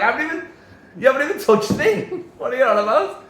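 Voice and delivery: high, loud imitation of an outraged player; mock indignant protest. Host mimics player arguing over a foul, mock indignant.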